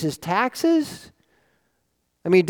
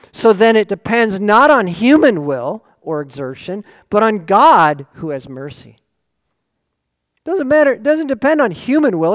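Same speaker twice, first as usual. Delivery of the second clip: louder, rising sermon delivery; forceful preaching; 4 semitones higher, 8 dB louder than usual. Acted.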